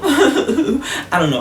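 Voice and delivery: laughing at himself, slow; embarrassed real laugh. Real, not acted. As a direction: giggle through the words. Laughing at own desperation.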